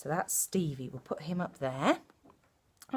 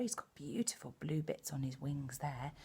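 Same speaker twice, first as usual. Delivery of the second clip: ordinary delivery, nothing marked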